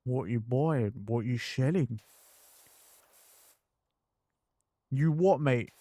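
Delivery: put-on Cockney accent. Voicing the Cockney character Dorian, light Cockney accent. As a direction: play a cheeky Cockney market trader. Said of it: "he was a Cockney".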